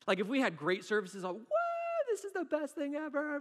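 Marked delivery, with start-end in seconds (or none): 1.3-3.4 high squealed whoop of joy; hammed-up, high-pitched thrill; mock elation. Pastor imitates himself after great services, over-the-top excited.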